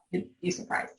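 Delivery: breathy sotto voce aside; whispered wry aside to the audience. Real, not acted. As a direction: drop to a conspiratorial whisper. Wry aside about opponents.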